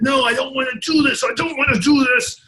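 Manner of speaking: retold desperate shout. A patient on the gurney refusing amputation, high shouting plea. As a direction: shout it like a man begging them to stop.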